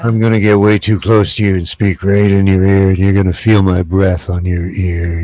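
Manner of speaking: leaning in close like a creepy uncle. Retold or imitated. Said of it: "It's a creepy uncle voice".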